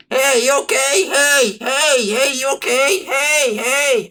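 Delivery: high, loud calls through a car window; shouting to wake them. Acted. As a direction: yell to wake the sleepers inside. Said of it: "I start banging on the windows".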